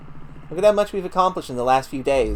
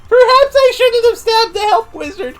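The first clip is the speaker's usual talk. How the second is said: laughing; 6 dB louder than usual. Real, not acted. Laughing at the dead wizard.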